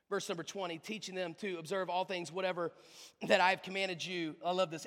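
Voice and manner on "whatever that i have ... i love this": ordinary delivery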